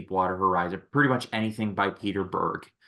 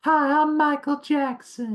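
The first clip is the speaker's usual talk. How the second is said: high breathy falsetto [name] voice; [name] impression; 3 dB louder than usual. Friend mimicking [name] in a mannequin sketch, high falsetto. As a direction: float it up high like the King of Pop. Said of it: "He's like".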